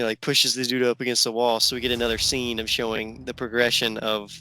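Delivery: normal talking voice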